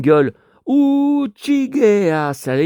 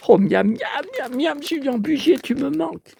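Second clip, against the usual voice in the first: mock longing; 10 semitones higher than usual.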